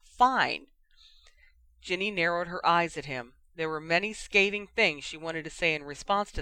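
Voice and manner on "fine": louder, spluttered single word; spluttering. Acted. Harry cornered by Ginny's question, spluttering loudly. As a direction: blurt it out, cornered. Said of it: "before spluttering".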